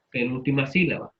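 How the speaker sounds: ordinary talk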